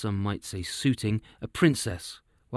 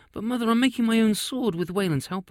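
put-on girl voice; 10 semitones higher than usual. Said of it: "Riley added".

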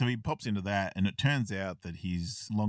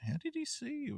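surprised question; 6 dB quieter than usual. Retold or imitated. Quoting Sam's surprise.

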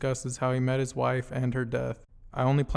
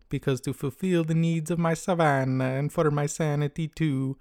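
hammed-up Italian accent, savoring the rhymes; Daniele Bolelli Italian accent. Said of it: "I'm going to impersonate him and it as best I can".

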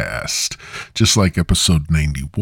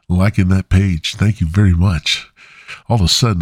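usual speaking voice

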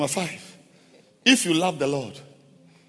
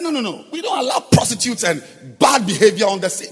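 retold rebuke, high and a bit louder; angry rebuke; 7 semitones higher, 6 dB louder than usual. Pastor retelling how he stopped the wedding reader, angrily. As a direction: relive scolding the reader, half-sung. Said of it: "I got angry".

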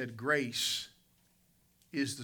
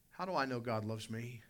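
ordinary talk, nothing marked

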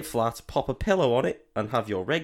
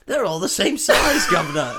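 cheeky cockney; 9 semitones higher, 9 dB louder than usual. Pretend. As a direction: answer back like a cheeky workman. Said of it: "And then he's like".

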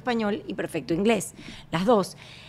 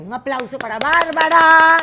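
calling for applause; 5 semitones higher, 8 dB louder than usual. Real, not acted. Host calls for applause.

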